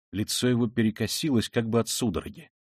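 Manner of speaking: usual speaking voice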